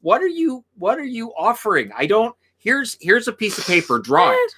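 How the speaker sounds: high-pitched, baffled pleading; acted bafflement. Acting out a listener lost in Newfoundlander dirty talk, baffled and high. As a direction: play someone who can't understand a word.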